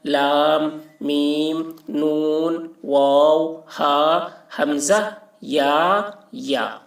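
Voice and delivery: letters like دال، سین، میم recited with full long ā and ī; measured Arabic alphabet recital, each name drawn out level; معروف pronunciation. Shown as a demonstration. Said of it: "ان سارے حروف کو معروف پڑھا گیا ہے". (معروف تلفظ، عربی حروف کھینچ کر)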